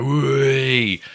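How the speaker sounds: high crowd whoop; crowd cheer. Retold or imitated. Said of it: "all the crowd go".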